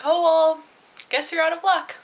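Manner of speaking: high, loud, mocking shrug of a line; sarcastic retort. To the guy nobody wants, loud sarcastic jab. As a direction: toss it off with scornful glee.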